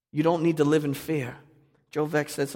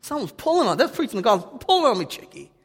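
hammed-up Boston Italian old man, much higher and louder; 10 semitones higher, 7 dB louder than usual. Pastor doing full-blown old Italian Joe from Boston, hammed-up accent. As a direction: ham up a seventy-six-year-old Boston Italian man, leaning on "pulling". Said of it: "That's how you talk in Boston when you're seventy-six in Italian".

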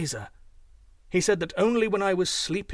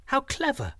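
put-on girl's realization; 10 semitones higher than usual. A narrator's reading. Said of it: "said Hugh Lin and Chang together".

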